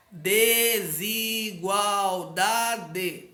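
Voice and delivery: DE-SI-GUAL-DA-DE muito devagar; sílabas separadas. Shown as a demonstration. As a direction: estique Desigualdade, sílaba por sílaba. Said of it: "bem separadinho as sílabas". (Desigualdade silabado, bem arrastado)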